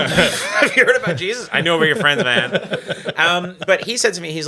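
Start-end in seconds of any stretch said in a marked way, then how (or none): 0.1-1.5 mock preacher. Mock proselytizer.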